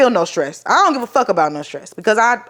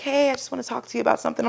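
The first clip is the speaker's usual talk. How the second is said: soft fast doctor voice; 3 semitones higher, 7 dB quieter, faster than usual. Retold or imitated. Doctor breaking bad news.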